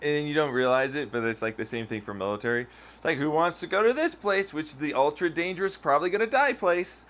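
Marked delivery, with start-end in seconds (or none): 3.2-7.1 mock pitch.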